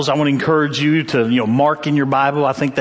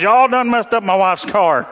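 mildly hammed-up irate husband, higher; angry husband caller; 5 semitones higher than usual. Husband calls pastor about wife's car, mock angry. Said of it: "some husband calls".

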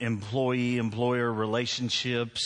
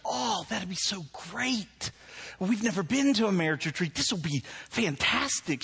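gushing wife; 8 semitones higher than usual. Retold or imitated. Wife thrilled about a marriage retreat, gushing. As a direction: imitate a thrilled wife, pitch way up. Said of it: "she's like".

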